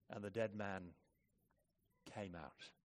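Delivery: low, soft, matter-of-fact reading; understatement. Retold or imitated. Preacher quotes John's account of the miracle, understated. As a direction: drop it quietly, letting the voice sink. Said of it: "one of the great understatements".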